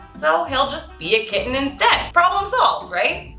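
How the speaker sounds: ordinary delivery, nothing marked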